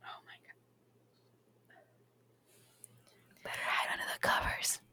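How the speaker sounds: hushed scared whisper. Acted. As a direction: whisper like hiding from ghosts. Whispered spooked reply.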